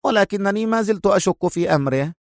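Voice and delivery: prompt, high-voiced backtrack; hasty correction. A narrator's reading. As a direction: hurry to add a reservation. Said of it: "ثم استدركت في سرعة".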